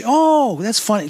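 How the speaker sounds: high, loud startled interjection; high surprised oh; surprised sister. Retold or imitated. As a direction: blurt it out, cutting him off. Sister cuts him off.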